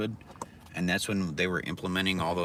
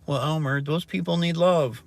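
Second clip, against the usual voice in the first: mock preachy voice; 8 semitones higher, 6 dB louder than usual. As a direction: lecture him like a sanctimonious do-gooder. Mocking a preachy voice.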